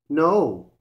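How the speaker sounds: loud, sharp refusal; a loud roar of refusal. A narrator's reading. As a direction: roar it like a big cat. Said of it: "roared Osibo".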